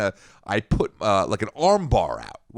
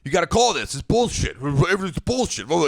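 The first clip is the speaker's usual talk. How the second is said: mock yell at ref; 6 semitones higher than usual. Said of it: "yelling at the ref".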